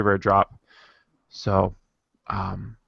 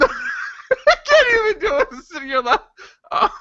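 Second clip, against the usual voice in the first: high squeal through real laughter; helpless laughter. Real, not acted. Podcaster giving up mid-reading, helpless real laughter. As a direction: laugh so hard the words barely escape. Cracking up mid-accent, gives up.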